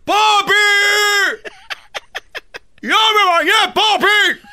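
hammed-up, high drawn-out yell; mock shouting. Son calling his papi back in Cuba, comic shouting. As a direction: bellow for papi at full pitch. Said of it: "así le dije a mi papi".

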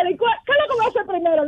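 high, fast quoted question; quick quoted question. Retold or imitated. Caller quoting her partner's eager question, fast. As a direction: rattle off his question.

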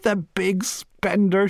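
high, slow mocking cry of laughter; scornful laughing cry. Missus Cork to Bernice, a high scornful laughing cry. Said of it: "she cried".

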